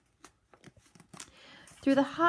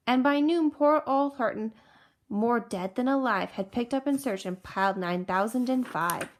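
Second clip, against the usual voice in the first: ordinary delivery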